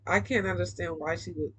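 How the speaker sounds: ordinary delivery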